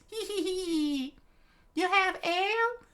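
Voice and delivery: very high giggle into a question; happy giant. A narrator's reading. Giant leans down to Usopp, happy squeal. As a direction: giggle gleefully in falsetto, then ask. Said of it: "with a happy face".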